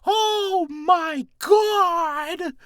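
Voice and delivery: mock strangled falsetto, very high and loud; squeaky high voice. Joking about a voice after a kick, high-pitched squeal. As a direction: squeal like you just got kicked. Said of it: "unless someone kicks me in the balls".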